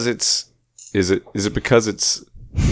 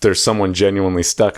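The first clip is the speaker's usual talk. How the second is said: ordinary delivery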